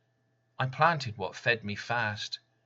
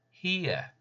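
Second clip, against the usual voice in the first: ordinary talk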